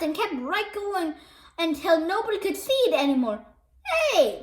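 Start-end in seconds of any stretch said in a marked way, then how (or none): 3.8-4.4 shout. A narrator's reading. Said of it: "shouted a fan".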